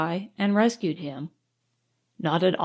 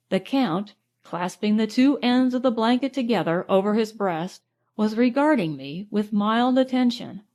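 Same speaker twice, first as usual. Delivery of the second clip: ordinary delivery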